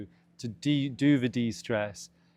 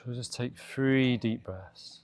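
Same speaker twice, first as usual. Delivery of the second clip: calm, measured meditation voice with long pauses; guided meditation. Real, not acted. Meditation teacher guiding listeners to de-stress after a busy day, calm and spacious. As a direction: ease them gently through each instruction, unhurried. Leads a body scan meditation.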